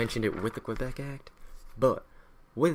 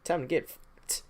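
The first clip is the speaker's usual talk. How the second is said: mock smug allies; 7 semitones higher, 5 dB quieter than usual. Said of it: "France and Spain get in there and be like".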